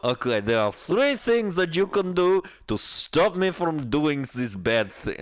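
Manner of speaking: put-on Russian accent, starting high and dropping. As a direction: deliver demands in a mock Russian accent. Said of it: "I'm not doing the Russian accent anymore".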